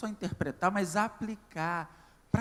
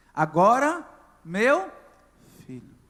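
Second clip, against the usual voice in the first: loud preaching cry; 3 semitones higher, 13 dB louder, slower than usual. Acted. Preaching climax.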